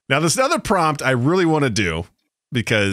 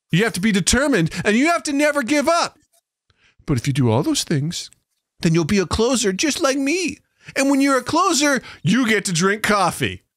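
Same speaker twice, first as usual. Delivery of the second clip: put-on high, gushing voice for a small child; exaggerated kiddie-talk, climbing higher; baby talk; 6 semitones higher than usual. Shown as a demonstration. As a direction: coax a little one along in a sing-song. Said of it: "pretending that you're talking to children".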